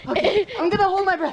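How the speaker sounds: fast, high and excited; rushing before diving in. Real, not acted. About to hold breath.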